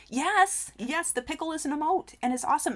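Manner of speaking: high, thrilled burst of yes; real excitement. Real, not acted. Thrilled about the new emote.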